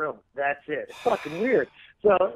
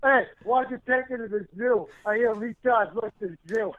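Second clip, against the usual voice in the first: higher, lightly acted movie tough guy; Rocky movie guy; 4 semitones higher than usual. Quoting the guy who teases Rocky about Adrian, movie impression. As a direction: needle him like the guy in Rocky. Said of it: "the guy's like".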